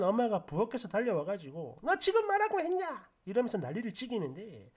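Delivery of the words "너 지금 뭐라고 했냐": much higher, stunned what-did-you-say; mother can't believe her ears; shocked outrage. Retold or imitated. Mother rushing from the kitchen, shocked and much higher.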